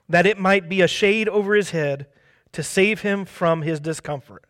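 ordinary delivery

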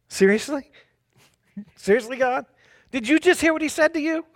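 rising disbelief, quick and high; can't believe what God just said; incredulous. Real, not acted. Preacher reacts to God's kindness.

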